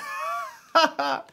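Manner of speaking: loud high-pitched laugh. Real, not acted. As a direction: burst out laughing, really high. Laughing at his own story.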